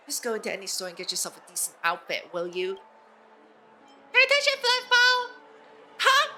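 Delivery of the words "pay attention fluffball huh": high, loud squeaky mascot voice. Acted. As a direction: yell it like a tiny cartoon mascot. Character lines read aloud.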